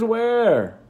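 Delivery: mother calling where. Retold or imitated. Mother searching for invisible son, calling loudly. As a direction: call out, searching. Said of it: "she says".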